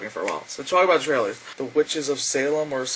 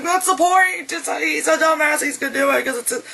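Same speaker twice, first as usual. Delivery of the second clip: high, strained mock shout; exasperated retort; 13 semitones higher, 3 dB louder than usual. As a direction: argue back in a raised pitch. Acted retort to the audience.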